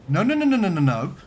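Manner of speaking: high frantic no no no; panicked shout. Real, not acted. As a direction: blurt it out in sudden panic. Buoyancy alarm in submarine game.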